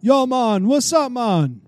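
loud, high put-on Jamaican accent; mock Jamaican greeting. Imagining answering a call from Jamaica, mock Jamaican accent. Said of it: "be like ... very heavy Jamaican accent".

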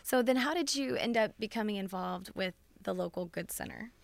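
ordinary talk, nothing marked

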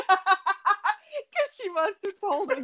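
slow, very high, laughing hard through it. Real, not acted. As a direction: squeal the words out through laughter. Laughing at brother's mistaken birthday message.

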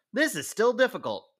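high-pitched mock protest; exasperated complaint. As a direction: act out a player's frustrated outcry. Acted reaction to hard game.

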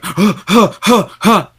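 imitated gasping in shock, high-pitched; shocked gasps. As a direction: mimic short, repeated panicked gasps. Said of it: "in such a state of shock where he's just like".

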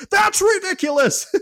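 hammed-up indignation, voice jumping way up; outraged disbelief. Ejected coach protesting the call, high mock outrage.

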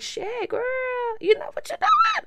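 very high squeaky mock exclamation; hammed-up squeal. Client hyping up her therapist, exaggerated squeal. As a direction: squeal the praise, voice shooting way up.

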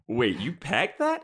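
high, drawn-out disbelief; incredulous. Retold or imitated. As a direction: react in baffled disbelief, pitch jumping up. Acted incredulous reaction to frying pan.

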